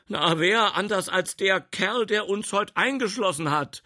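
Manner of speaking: high, loud, agitated policeman; agitated exclamation. Acted. Policeman excitedly identifies the culprit. (aufgeregt herausgerufen)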